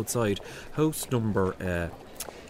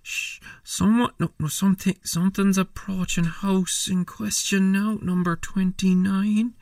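tense alarm; 6 semitones higher, 5 dB louder than usual. Acted. Tense whispered stakeout alarm.

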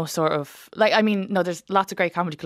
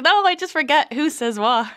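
high, loud, flustered self-defence; exasperated protest; 10 semitones higher, 6 dB louder than usual. Retold or imitated. Comic retelling her reply to her teasing family, high exasperated protest. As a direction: throw up your hands and protest. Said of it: "And I'm like".

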